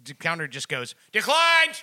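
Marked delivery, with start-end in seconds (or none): 1.1-1.8 loud, high call of Declined!; yelled announcement. Retold or imitated. Cashier yelling at the customer, loud and high. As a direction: bark it out for the whole store. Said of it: "She's just yelling it".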